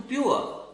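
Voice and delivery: प्योर as British "pyoo-uh", no R; British silent R. Shown as a demonstration. Teacher shows the British English form, प्योर as "pyoo-uh". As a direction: drop the R in प्योर, ending on "uh". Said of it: "British English में नहीं बोलते".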